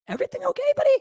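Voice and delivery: gentle concerned check-in. Retold or imitated. Host glancing at the silent guest, careful concerned check-in.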